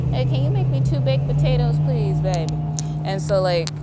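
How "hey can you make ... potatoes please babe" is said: high sweet asking voice; mom asking sweetly. Retold or imitated. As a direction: sweetly ask for a favour. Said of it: "she'll be like".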